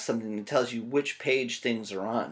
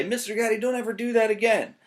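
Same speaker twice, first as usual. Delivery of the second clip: put-on high, scolding kid voice; playful pretend scolding; mock scolding student; 7 semitones higher, 4 dB louder than usual. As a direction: tell the teacher off in a squeaky voice. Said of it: "You can say".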